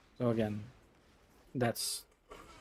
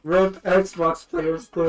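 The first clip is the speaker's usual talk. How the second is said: slow, drawn-out, louder and higher, mock grief; mock mourning; 4 semitones higher, 5 dB louder, much slower than usual. Real, not acted. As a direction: stretch it out like a fake eulogy. Mock mourning Xbox players.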